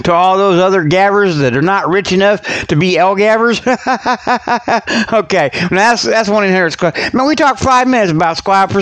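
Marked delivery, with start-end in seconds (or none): 3.7-5.1 short amused chuckle; laugh. Real, not acted. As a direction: laugh at your own joke. Own joke laugh.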